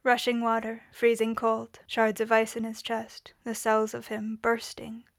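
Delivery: ordinary delivery, nothing marked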